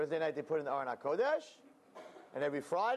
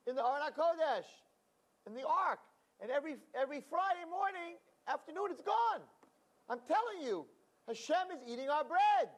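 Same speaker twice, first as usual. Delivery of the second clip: much higher, eager pleading voice; excited; 12 semitones higher than usual. Acted. Ignorant man insisting to the rabbi that God eats, high and eager. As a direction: bubble over with simple joy.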